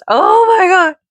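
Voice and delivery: put-on high, loud, silly baby voice. Mom mocking her own ridiculous voice, in high baby voice. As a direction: exclaim it in a put-on baby squeal.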